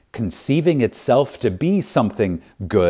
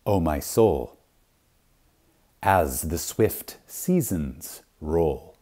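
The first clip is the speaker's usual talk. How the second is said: slow, solemn poem reading; measured, reverent verse, voice sinking at the end; poem recital; 2 semitones lower, slower than usual. Acted. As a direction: recite it slowly like a closing benediction.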